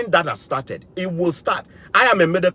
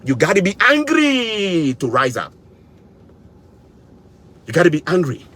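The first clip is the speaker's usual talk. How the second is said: impassioned anger. Real, not acted. Rallying viewers to get angry.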